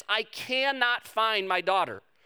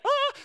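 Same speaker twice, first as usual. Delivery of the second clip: high-pitched mock gasp of delight; excited gasp. Finder of a lost phone, acted excited gasp. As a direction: let out a high, thrilled gasp. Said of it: "you are so excited that your phone is back".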